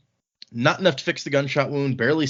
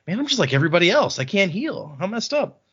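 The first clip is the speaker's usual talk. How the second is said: fast, higher, a touch whiny; mock whiny Wayne; 7 semitones higher than usual. Podcaster voicing Wayne, lightly whiny and fast. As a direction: rattle it off like a sulky Wayne. Wayne voiced in book retelling.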